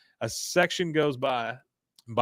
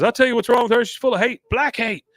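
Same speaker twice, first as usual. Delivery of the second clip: heated retort; 11 semitones higher, 6 dB louder than usual. Retold or imitated.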